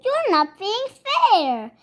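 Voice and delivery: a touch of spluttering cubs; mild cub protest; lightly voiced cubs protesting. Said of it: "spluttered the Cubs".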